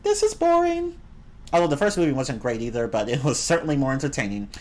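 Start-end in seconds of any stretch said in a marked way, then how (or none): 0.0-0.9 bored line in a much higher put-on voice; high, whiny complaint; mock bored. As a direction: toss it off in a silly high voice. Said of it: "I'm like".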